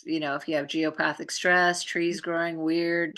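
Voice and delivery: ordinary talk, nothing marked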